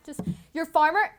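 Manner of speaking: normal talking voice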